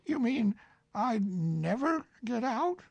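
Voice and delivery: lightly voiced Winnie-the-Pooh, polite.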